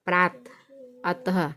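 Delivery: प्रात and अतः each ending in a breath of ह; visarga h sound. Shown as a demonstration. Teacher giving visarga examples to pupils, प्रात, अतः ending in ह. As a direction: let अतः and प्रात close on an audible ह. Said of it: "जो ह की ध्वनि आती है".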